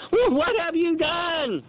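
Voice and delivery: high, slow, frightened question; alarmed sailors. Acted. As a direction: ask in dread. Terrified sailors question Jonah.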